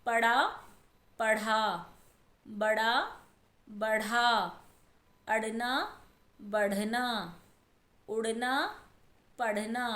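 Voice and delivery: each word set apart with pauses, contrasting ड़ and ढ़; teacher reading minimal pairs aloud one by one; careful pronunciation drill. Shown as a demonstration. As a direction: read the word pairs slowly so children hear the difference. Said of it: "इसमें हम अंतर देखेंगे".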